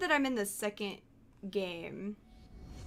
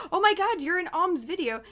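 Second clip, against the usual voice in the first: higher, louder gushing; excited fan quote; 8 semitones higher, 5 dB louder than usual. Retold or imitated. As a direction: imitate excited viewers. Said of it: "they're like".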